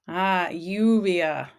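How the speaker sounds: lluvia said slowly, ll as a y, YOO-vya; slow lluvia with the double l as y; lluvia with a y sound. Shown as a demonstration. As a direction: stretch lluvia out so the y is clear. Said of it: "two L sounds like a Y".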